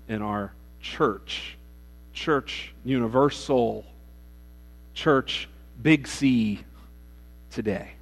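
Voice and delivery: drawn-out, weighty stress on each word; slow down and land every word; slow emphasis. Acted. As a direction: pastor hammering the point to the church. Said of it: "emphatic slow sermon point".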